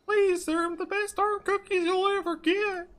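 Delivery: slow, very high falsetto plea; put-on high voice, begging through fake tears; drawn-out high-pitched pleading; tearful cookie seller. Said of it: "the guy suddenly bursts into tears".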